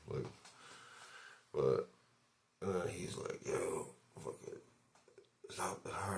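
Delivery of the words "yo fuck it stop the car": hushed, breathy command; a mock whispered warning from a stranger; retold whispered order. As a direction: whisper it like a tense threat. Retold stranger ordering him to stop.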